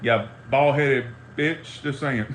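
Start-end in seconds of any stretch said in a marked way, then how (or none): none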